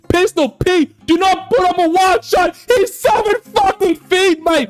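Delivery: frustrated yelling. Real, not acted. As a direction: yell at the player who keeps messing up. Yelling at his own player.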